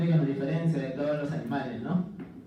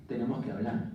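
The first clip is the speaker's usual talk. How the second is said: put-on ominous girlfriend; 7 semitones lower, 5 dB quieter than usual. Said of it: "La clásica de las chicas".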